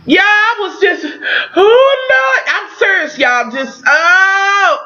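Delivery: high, teary voice breaking into sobs; overwhelmed, crying real tears while talking. Real, not acted. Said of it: "I'm too motherfucking emotional right now".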